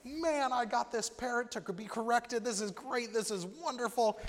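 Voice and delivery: ecstatic John. Acted. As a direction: burst with joy at the reformed parrot. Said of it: "John's ecstatic".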